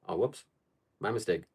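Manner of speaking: ordinary talk, nothing marked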